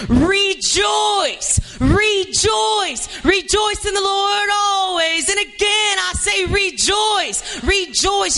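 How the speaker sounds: preacher raising the congregation, shouting rejoice at full force; shouted, high. Shown as a demonstration. Joyful preaching shout. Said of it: "I'm gonna say it again".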